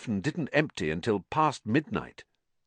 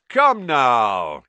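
loud, higher, hearty exclamation; beaming cry; 4 semitones higher, 9 dB louder than usual. A narrator's reading. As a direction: call out cheerily to the whole room. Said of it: "he cried, beaming around him".

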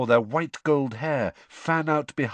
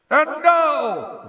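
booming, high-pitched announcer's roar; 9 dB louder than usual. A narrator's reading. Ludo Bagman announcing to the stadium, roaring. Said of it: "roared Ludo Bagman's voice".

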